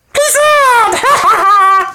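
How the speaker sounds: very high, loud send-off; shouted sign-off. Acted. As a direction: yell it out like a cheer.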